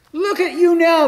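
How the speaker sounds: high, loud jeering shout; mocking yell. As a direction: yell it up at him, taunting. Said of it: "they yelled at him".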